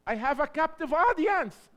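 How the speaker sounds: bursting with eagerness; real joy retelling his prison mission; excited. Real, not acted. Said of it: "I was excited".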